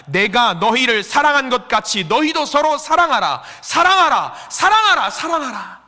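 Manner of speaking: building from firm reading to high, loud cries; emphatic command, building. Acted. Jesus commanding disciples to love, building to a shout.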